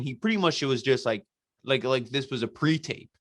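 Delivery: normal talking voice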